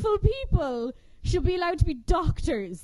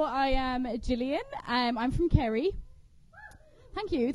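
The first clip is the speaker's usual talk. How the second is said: normal talking voice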